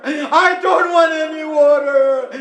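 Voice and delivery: high lamenting cry, repeated; weeping plea. Acted. Sakina to her uncle, repeated weeping plea. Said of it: "And Sakina says".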